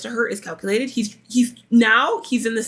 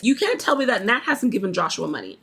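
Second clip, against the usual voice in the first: ordinary talk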